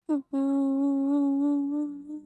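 long drawn whimpering hums; muffled sobs. Acted. Sobbing after being scolded.

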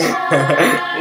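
amused burst of laughter. Real, not acted. Viewer cracking up at the cartoon, real laugh.

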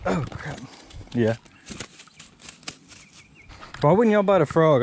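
usual speaking voice

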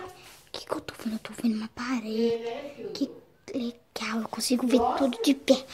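soft secret whisper. Pretend. Kid peeking at the scene, whispering so nobody hears. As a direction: hush it like you're spying.

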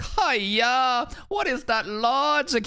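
put-on Hong Kong teacher accent, high and drawn out; imitating a teacher with a thick Cantonese accent. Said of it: "he had the thickest Kanto accent".

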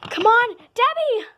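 high loud rallying call; shouting. Acted. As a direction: yell across the room. Calling friend to chase.

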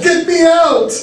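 high-pitched bawl; high, desperate holler. A narrator's reading. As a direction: cry out high for rescue. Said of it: "I bawled".

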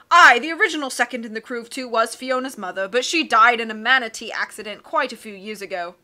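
loud, hearty captain voice; blustery sea captain. Acted. Captain puzzling over charts, loud and hearty. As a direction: boom like a cheerful old sea dog.

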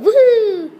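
high, loud celebratory woohoo with a laugh; excited whoop. Pretend. Footballer celebrating his goal, loud excited whoop. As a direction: cheer like you just scored.

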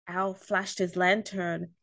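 ordinary delivery

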